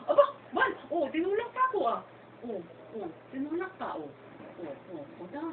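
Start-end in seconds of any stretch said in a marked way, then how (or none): 0.0-1.8 high surprised cry at the push; high-pitched surprised exclamation. Real, not acted. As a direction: react in mock shock at being pushed. Baby pushes her.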